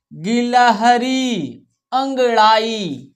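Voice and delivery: slow word reading with long syllables; lecture en chœur. Shown as a demonstration. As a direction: draw out each word for pupils to follow. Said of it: "हमारे साथ पढ़ना है आपको".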